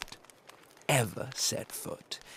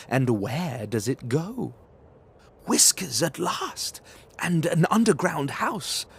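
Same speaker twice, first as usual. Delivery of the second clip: put-on a kid hunting with gusto; brave child; 4 dB louder than usual. Gruffalo's child searching the snow, excited.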